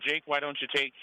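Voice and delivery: normal talking voice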